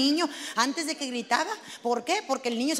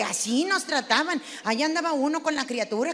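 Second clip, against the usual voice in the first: ordinary delivery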